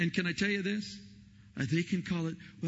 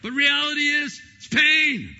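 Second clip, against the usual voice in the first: much higher, louder with a laugh; emphatic; 12 semitones higher, 10 dB louder than usual. Real, not acted. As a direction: laugh and belt it out.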